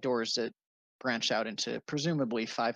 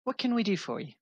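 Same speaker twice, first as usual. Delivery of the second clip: polite cleric woman; 8 semitones higher, faster than usual. Acted. Cleric asks party's business.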